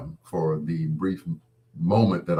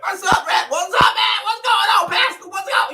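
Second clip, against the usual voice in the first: much higher, louder, laughing shout; mock the squeaky buddy voice; high hammed-up what's up; excited greeting; 7 dB louder than usual. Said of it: "I'm like".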